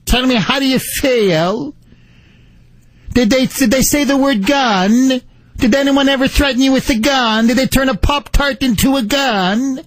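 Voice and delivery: mock British interviewer grilling a guest; Piers Morgan impression. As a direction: put on a puffed-up British interviewer voice. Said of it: "making fun of my English accent".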